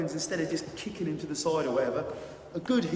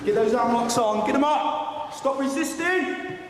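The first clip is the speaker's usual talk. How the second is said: shouted commands; 7 semitones higher than usual. Real, not acted. Instructor barks orders during drill.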